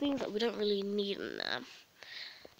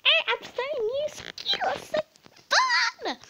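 cheery new pupil; 5 dB louder than usual. Pretend. Pretend pupil gushing about starting school. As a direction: sing out the excitement like a brand-new pupil. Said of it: "the next person".

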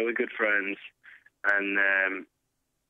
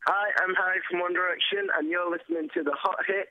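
radio ident; 8 semitones higher than usual. Acted. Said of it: "radio station ident read".

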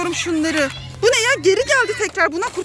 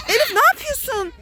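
mock alarm; 6 semitones higher than usual. Mother acts out magic-potion game.

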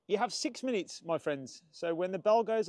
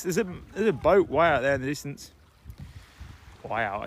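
ordinary talk, nothing marked

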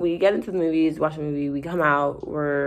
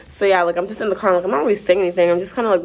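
ordinary talk, nothing marked

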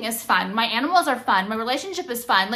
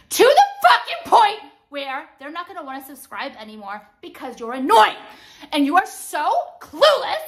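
loud, high exasperated rant; angry, emphatic outburst; boiling over; 4 semitones higher, 5 dB louder than usual. Real, not acted. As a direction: scold at full irritation. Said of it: "I've reached my boiling point".

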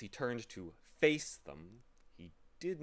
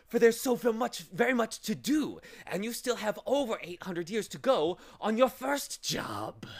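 loud, high-pitched villainous insistence; menacing order; 13 semitones higher, 11 dB louder than usual. A narrator's reading. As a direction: pile on the work with menace. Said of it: "he ordered, with a menacing sweep".

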